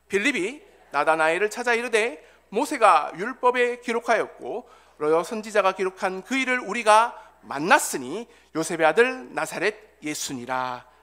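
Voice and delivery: loud, measured congregational reading; scripture read aloud. Acted. Preacher leading the congregation in John's gospel, loud measured reading. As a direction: lead the verse out loud, phrase by phrase.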